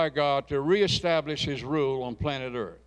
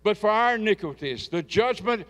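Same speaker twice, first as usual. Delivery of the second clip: raised, higher voice declaiming the verses; preached scripture reading; 6 semitones higher, 4 dB louder than usual. Acted. Pastor reading Isaiah aloud to the congregation, declaiming. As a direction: proclaim the verses over the church.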